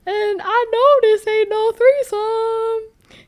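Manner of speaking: high, loud, drawn-out protest; incredulous indignation. Real, not acted. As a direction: cry it out in scandalized disbelief. Indignant at what was seen.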